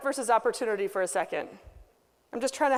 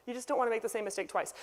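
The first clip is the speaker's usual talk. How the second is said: ordinary talk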